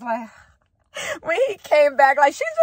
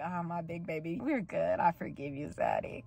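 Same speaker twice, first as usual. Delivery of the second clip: hushed, low cooing to a lover, imitated; tender forgiveness; 7 semitones lower, 13 dB quieter than usual. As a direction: coo it softly and low. Voices girlfriend forgiving her partner.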